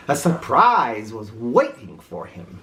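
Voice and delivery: ordinary delivery, nothing marked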